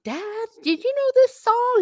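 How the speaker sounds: very high, eager call to a parent; excited retold question. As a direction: ask Dad in amazed, high pitch.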